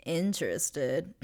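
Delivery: slow, rising "interested" with air quotes; drawn-out ironic stress. Retold or imitated. Mocking Dorsey's fake interest in Kate, slow and rising. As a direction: stretch "interested" out slowly, rising, with irony. Sarcastic aside about Dorsey.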